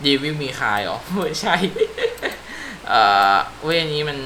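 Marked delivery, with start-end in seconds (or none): 1.6-2.8 high giggle. Real, not acted. Joke about Devil May Cry.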